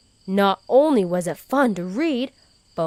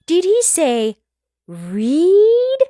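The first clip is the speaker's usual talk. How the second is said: surprised realization; 9 semitones higher, 6 dB louder than usual. Acted. Sudden realization.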